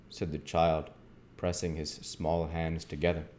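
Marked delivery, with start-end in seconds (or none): none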